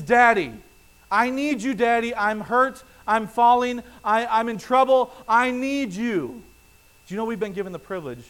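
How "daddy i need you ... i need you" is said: put-on loud, high, pleading little child's cry; child crying out for daddy. Toddler who stubbed her toe, crying out to daddy, loud and pleading. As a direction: call out like a hurt toddler needing her father. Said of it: "The thing that she would cry out is".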